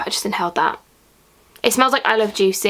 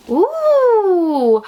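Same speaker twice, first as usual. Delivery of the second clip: high, loud drawn-out ooh; delighted ooh; 10 semitones higher, 5 dB louder than usual. Real, not acted. Pulling surprise item from box, delighted loud ooh. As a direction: squeal with surprise at the find.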